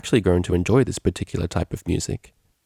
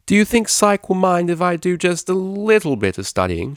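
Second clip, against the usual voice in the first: raised voice over the noise; loud over music; 11 semitones higher than usual. A narrator's reading. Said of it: "she said loudly over the music".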